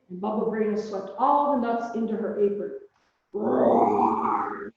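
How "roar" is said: a much lower, loud roar in a bear voice; grumpy storybook bear roaring. A narrator's reading. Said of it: "Bear roared".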